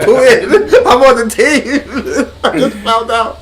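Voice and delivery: high, laughing pretend excitement; mock eager convert. Joking as the son who just found out, high mock eagerness. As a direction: play an overexcited new recruit, cracking up.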